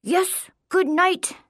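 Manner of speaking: high, slow, formal reply; put-on knight voice. A narrator's reading. Boy answers his challenger in the game, slow knightly voice. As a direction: answer grandly, drawing it out.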